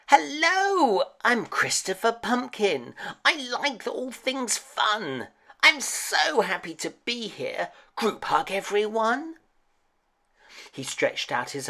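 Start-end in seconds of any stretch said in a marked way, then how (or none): 0.1-9.4 high, sing-song happy little character; sweet, high-pitched pumpkin bursting with friendliness; cheerful friendly pumpkin. A narrator's reading. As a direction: greet everyone in a high, gleeful cartoon voice. Pumpkin introduces himself in story.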